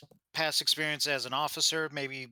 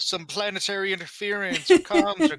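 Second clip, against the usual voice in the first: mock alarm; 5 semitones higher, 5 dB louder than usual. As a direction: play up a fake signal failure, stretching the last words. Fakes comms cutting out on chatterer.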